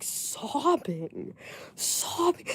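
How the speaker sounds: slow, soft mock-sobbed words; imitating sobbing. Imitating the friend's sobbing, slow and soft. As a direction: sob the word out mockingly. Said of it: "never seen anybody sob like that".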